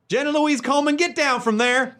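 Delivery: Southern mom. Acted. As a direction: call the child down in a high Southern accent. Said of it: "I can picture a Southern mom".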